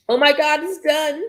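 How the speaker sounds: high, loud burst of excitement; excited relief. A narrator's reading. Narrator voices the designer just after clicking post, excited. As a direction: exclaim it high and thrilled, as narrated dialogue. Character has just posted her launch.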